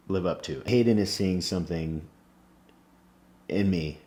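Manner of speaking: usual speaking voice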